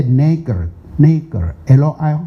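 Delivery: neg- said very slowly with an "eh" vowel, then L I spelled out; slow syllable drill. Shown as a demonstration. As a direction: break "neg-" apart slowly with an "eh" sound. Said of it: "他们是发呃的音".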